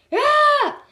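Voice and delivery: high, loud scream of frustration; frustrated scream. A narrator's reading. Principal screaming in frustration at his secretary, high loud yell. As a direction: let out a furious shriek. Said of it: "screamed Mister Krupp in a frustration".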